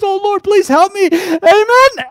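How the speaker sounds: high, loud, hammed-up plea for help; desperate cry. Rider praying at the cliff edge, desperate hammed-up cry. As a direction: wail it like a last frantic prayer. Rider prays before the drop-off.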